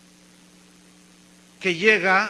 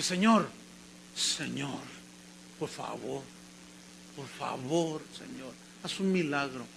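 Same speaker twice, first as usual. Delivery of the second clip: soft, low pleading, imitating a weeping son; tearful prayer, retold; 6 semitones lower, 10 dB quieter than usual. Son praying in a corner for his father, retold tearful plea. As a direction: plead quietly in short broken phrases, as if crying. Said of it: "yo estaba llorando".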